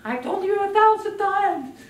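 high, loud exasperated parent; mock nagging parent. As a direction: play the fed-up parent.

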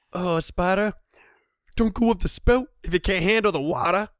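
loud, high gloating taunt at the spider; mock taunt. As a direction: rub it in with a sing-song jeer. Taunting spider after kill.

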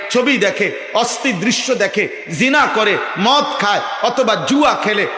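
forceful, insistent sermon delivery; impassioned preaching. Real, not acted. Preacher challenges young men on forsaking the faith, impassioned preaching. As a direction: press each question on the congregation like a preacher.

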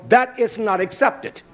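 ordinary delivery

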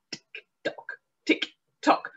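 soft unvoiced clock ticking; whispered tick-tock. A narrator's reading. Clock noise in story.

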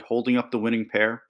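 ordinary delivery, nothing marked

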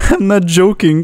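high-pitched, half-laughing fright; real fright. Real, not acted. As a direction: insist you really are scared, voice jumping up. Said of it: "Now I'm actually terrified".

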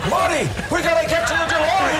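frantic, high-pitched mad scientist shout; Doc from Back to the Future. Acted. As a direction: cry out urgently in a very high voice. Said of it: "Doc from, uh, uh, the Back to the Future".

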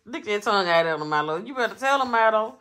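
loud, high scolding at the dog; scolding shout. Real, not acted. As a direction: yell at the dog to stop.

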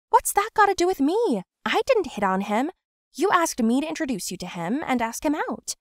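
indignant defense. Acted. Quarrel, defending herself.

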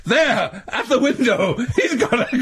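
hammed-up dramatic shout.

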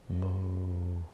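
long, level mock moo; imitated bull moo. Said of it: "the sound effects of a bull".

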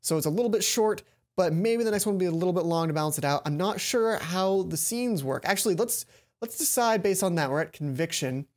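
ordinary talk

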